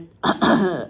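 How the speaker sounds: loud, rough throat clear; real throat-clearing cough. Real, not acted. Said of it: "I've got a frog in my throat".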